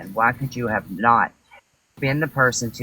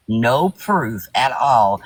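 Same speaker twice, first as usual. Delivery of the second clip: drawn out word by word, a bit louder; slow emphasis; 4 dB louder, much slower than usual. Real, not acted. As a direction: spell out no proof at all. Hammering the accusation.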